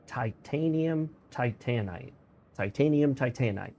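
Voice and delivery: TITANITE as tie-TAN-ite, paired with TITANIUM; pronunciation comparison. Shown as a demonstration. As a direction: stress the second syllable in both Titanium and Titanite. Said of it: "based on the word titanium".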